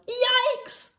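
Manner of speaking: high, loud shocked yelp; father yelling in shock at the cart; startled yell. Acted. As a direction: jump back in alarm. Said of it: "her father turned around and yelled".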